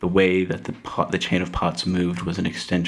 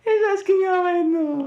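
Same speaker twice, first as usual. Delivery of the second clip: mocking high voice.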